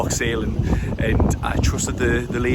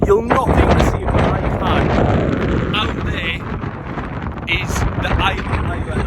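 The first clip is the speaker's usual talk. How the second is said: shouting over wind; 8 semitones higher than usual. Real, not acted. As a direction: raise it over the gusts. Windy clifftop.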